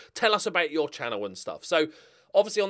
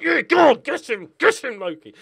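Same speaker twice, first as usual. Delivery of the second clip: higher, louder egging on; mock cheering fans; 10 semitones higher, 10 dB louder than usual. Spurs fans egging Loki on with a mock cheer. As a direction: cheer like a rival fan on the terraces. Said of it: "Spurs fans are like".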